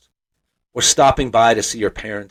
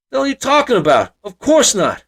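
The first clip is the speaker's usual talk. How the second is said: gruff dad dismissing; 12 semitones higher, 4 dB louder than usual. Retold or imitated. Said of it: "He blinks".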